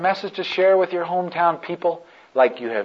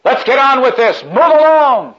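loud, higher barked order; soldier shouting; 8 semitones higher, 7 dB louder than usual. Acted. Centurion ordering the cross-bearer to keep moving, shouting. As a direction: shout the centurion's command to hurry on. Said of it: "the soldier shouts out".

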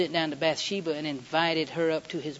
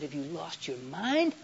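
incredulous question; slower than usual. Retold or imitated. King David confronts the sleeping husband, incredulous. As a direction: draw it out, rising steeply on the last word.

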